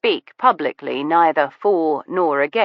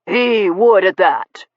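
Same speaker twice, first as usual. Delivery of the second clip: gentleman's voice; 3 semitones higher, 3 dB louder, slower than usual. A narrator's reading. Steele agreeing slowly.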